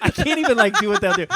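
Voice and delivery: high, breaking into laughter; laughing. Real, not acted. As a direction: try to talk through your own laughter. Laughing while retelling.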